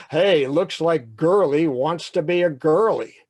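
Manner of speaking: loud sing-song taunt; mock your victim loudly; jeering bully. Benny taunting the boy up close, jeering.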